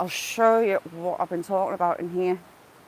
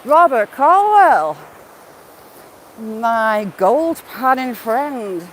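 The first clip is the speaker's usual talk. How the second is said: louder, slow, drawn-out hello; excited greeting; 5 dB louder, much slower than usual. Real, not acted. Streamer greeting a gold panning friend, excited. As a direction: call his name out like a warm welcome.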